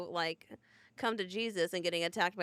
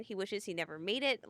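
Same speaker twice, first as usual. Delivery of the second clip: ordinary talk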